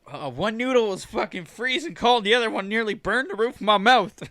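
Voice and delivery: high, loud complaining grandma voice; outraged grandma. Acted. Grandma reacting to uneven microwaved macaroni, high-pitched outrage. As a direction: rant at full pitch as Grandma. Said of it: "She goes".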